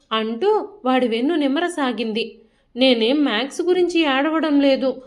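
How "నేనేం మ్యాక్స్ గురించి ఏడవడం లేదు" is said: ordinary delivery, nothing marked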